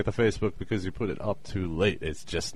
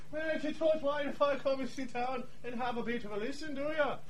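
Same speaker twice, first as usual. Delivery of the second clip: character voice; 8 dB quieter, faster than usual. Acted. S0 as O'Neill again.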